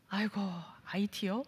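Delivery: a soft dismayed groan at the news; reluctant groan. Retold or imitated. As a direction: mumble it like you hoped not to go. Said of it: "제가 어떻게 했을까요?".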